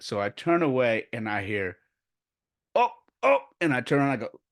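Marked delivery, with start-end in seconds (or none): 2.7-3.4 high, loud yelps imitating a fall; startled oh-oh. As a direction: imitate alarmed little cries while toppling.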